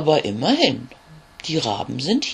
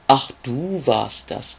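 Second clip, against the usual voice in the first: lightly croaked, slow raven line; a hint of a croak, drawn out slowly; slower than usual. Raven finally spots the tiny beetle, slow light croak. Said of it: "krächzt er laut".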